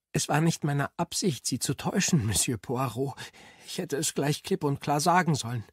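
a hint of a frightened niece apologising; flustered young woman. A narrator's reading. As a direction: blurt it out, anxious to explain. Annabel flustered confession.

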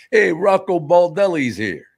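loud, high, drawn-out announcement; neighborhood collector. Acted. Neighborhood guy coming for the vig, loud mock announcement. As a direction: announce a feared local tough guy's arrival, slowly. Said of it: "some guy in the neighborhood coming".

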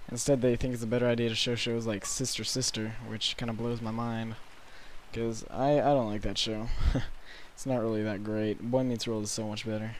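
ordinary delivery

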